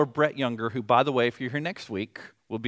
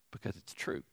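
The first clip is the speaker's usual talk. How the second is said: hushed whisper; whispered, much softer; 8 semitones lower, 14 dB quieter than usual. Shown as a demonstration. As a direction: breathe it out as a whisper. Preacher states the point, then repeats it.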